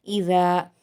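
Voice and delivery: "इज़ा" with the final aa stretched two counts; two-count elongation. Shown as a demonstration. Teacher showing a madd of two harakat, lengthened "इज़ा". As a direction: lengthen the last vowel of "इज़ा" evenly. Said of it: "दो हरकत खींचेंगे".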